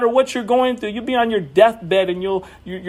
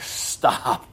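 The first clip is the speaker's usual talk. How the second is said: a soft unvoiced whisper of Stop; 7 semitones lower, 6 dB quieter than usual. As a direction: whisper it like a voice from above. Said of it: "And he's like".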